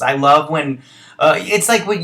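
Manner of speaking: ordinary talk, nothing marked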